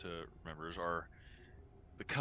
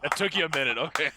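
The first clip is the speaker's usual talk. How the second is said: loud, high, laughing tease; 8 semitones higher, 16 dB louder than usual. Real, not acted. Player teasing the table for getting the pun late, laughing. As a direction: crow it through real laughter.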